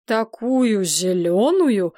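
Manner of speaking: slow, drawn-out disgusted horror; horrified. A narrator's reading. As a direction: stretch it out, aghast. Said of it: "ужаснулся король и сам позеленел". (с ужасом, протяжно)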